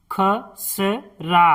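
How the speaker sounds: खसरा drawn out slowly as ख-स-रा; slow syllable-by-syllable. Shown as a demonstration. Teacher sounding out खसरा for pupils, slowly. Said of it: "इस तरह से".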